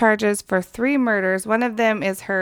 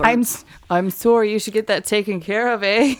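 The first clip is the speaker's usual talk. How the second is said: lightly put-on Canadian accent; mock Canadian with a tagged-on "eh"; slight Canadian accent. Shown as a demonstration. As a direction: apologise in a half-hearted Canadian accent. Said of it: "slightly say in a Canadian accent".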